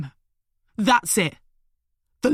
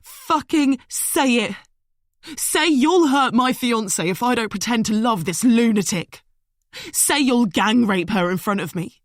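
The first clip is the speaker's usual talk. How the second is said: furious tirade; 6 semitones higher, 4 dB louder than usual. A narrator's reading. Liam raging at Smith from the branch. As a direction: spit every word at them.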